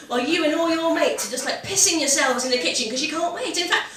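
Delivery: ordinary delivery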